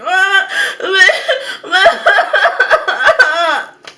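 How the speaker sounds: loud, high exaggerated wailing sobs; hammed-up bawling in bursts; mock sobbing. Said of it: "full out sobbing".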